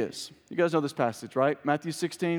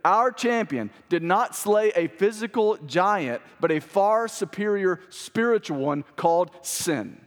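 emphatic, raised preaching delivery; 3 semitones higher than usual. Acted. Preacher proclaiming Christ's victory to the church, emphatic preaching. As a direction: preach it in weighty phrases with pauses.

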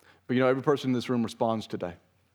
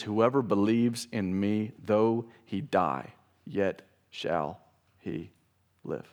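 slow solemn reading; much slower than usual. Acted. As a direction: let each phrase land slowly. Reading scripture slowly.

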